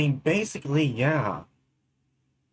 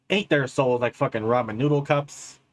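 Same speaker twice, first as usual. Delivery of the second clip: ordinary talk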